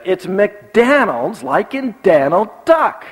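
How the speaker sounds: put-on high, slow, insistent kid's retort; stubborn child. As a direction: imitate a child drawing out the wrong vowel. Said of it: "And he said".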